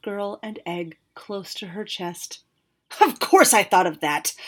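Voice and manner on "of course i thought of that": louder, sharp retort; snapped. Acted. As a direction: bite back at the dragon. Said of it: "Zazimos snapped".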